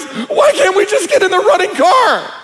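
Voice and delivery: put-on high-pitched scared teen voice; mock panicky teen whisper-shout; horror movie teen. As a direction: squeak it like a nervous teen in a slasher film. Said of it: "the blonde goes".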